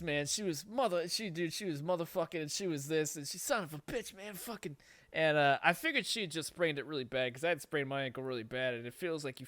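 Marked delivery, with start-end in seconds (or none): 3.4-4.8 imitating pained cursing. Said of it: "she was pissed".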